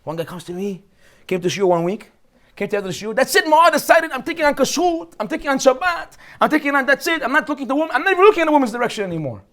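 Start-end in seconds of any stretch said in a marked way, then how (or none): 3.1-9.4 overeager convert. Retold or imitated. Eager new religious guy.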